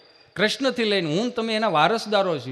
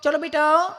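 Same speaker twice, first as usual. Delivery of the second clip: sweet-talking mom; 10 semitones higher than usual. Retold or imitated. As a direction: coax little Chintu sweetly. Modern mother coaxing son. (ચિંટુને લાડથી મનાવતી મમ્મીનો ઊંચો ધીમો અવાજ)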